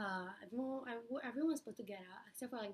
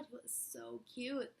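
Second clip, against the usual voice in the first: ordinary talk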